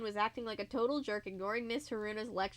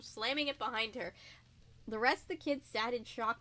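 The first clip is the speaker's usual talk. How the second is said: ordinary delivery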